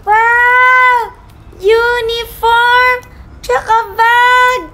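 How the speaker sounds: slow, drawn-out awe; thrilled at a surprise present; delighted wonder. Acted. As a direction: savour each new gift out loud. Child receives surprise school gifts.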